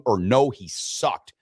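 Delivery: slowly, no, he sucked, flat drop; slow deadpan option. Shown as a demonstration. Host reading poll answers to cohost, slow and dry. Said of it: "two ways that you can answer".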